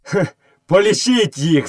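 high, contemptuous call; scornful shout. A narrator's reading. Mowgli daring the villagers to count, scornfully. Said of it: "зневажливо закричав Мауглі".

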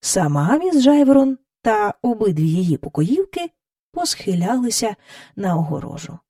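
normal talking voice

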